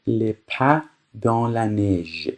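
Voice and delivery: very slow, careful French reading; slow, clear dictation. Shown as a demonstration. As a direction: read it out slowly for learners. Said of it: "teacher reads French slowly".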